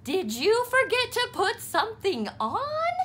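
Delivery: mother quizzing Froggy; mother asking. A narrator's reading. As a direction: ask with a knowing tease.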